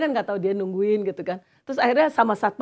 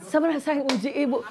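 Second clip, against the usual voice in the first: higher, acted voice of the testing woman; voiced counselee; 5 semitones higher, faster than usual. Woman admitting she was testing the counselor, higher acted voice. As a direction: play her sly reveal.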